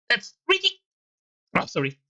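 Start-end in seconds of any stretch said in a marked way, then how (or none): 0.1-0.8 very high, put-on objecting voice; high-pitched protest. Game character objects to an accusation, in a very high put-on voice.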